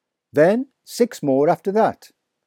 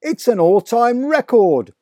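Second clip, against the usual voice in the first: high, loud, slow elation; father thrilled at the count; triumphant; 9 semitones higher, 6 dB louder than usual. A narrator's reading. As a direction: proclaim the record.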